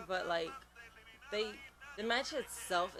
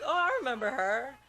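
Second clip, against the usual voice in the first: higher, louder delighted recognition; nostalgic parents; 6 semitones higher, 7 dB louder than usual. Retold or imitated. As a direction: gush like parents remembering her. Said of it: "moms and dads to be like".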